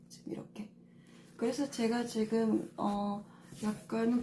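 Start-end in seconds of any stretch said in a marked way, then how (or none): 0.1-0.7 whisper. Real, not acted. Teacher shows colors softly.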